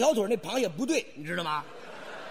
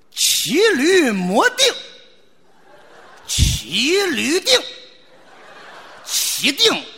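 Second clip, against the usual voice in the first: slow, loud, drawn-out couplet recital; hammed-up verse recital, each word stretched; grand recitation; 6 semitones higher, 6 dB louder, much slower than usual. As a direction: declaim it like a proud poet. Reciting couplet line with flourish.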